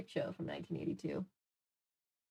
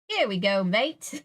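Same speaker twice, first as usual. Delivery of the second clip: bad English accent, louder, drifting Aussie; attempted English accent; 4 dB louder, slower than usual. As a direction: play an English waiter with a shaky accent. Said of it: "I'm trying to do an accent".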